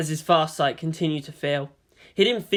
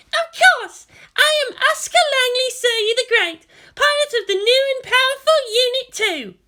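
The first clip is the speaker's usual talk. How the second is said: much higher, loud proud declaration; boastful Asuka; 6 dB louder than usual. A narrator's reading. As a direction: proclaim yourself great like a bragging girl. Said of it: "held her right fist over her heart".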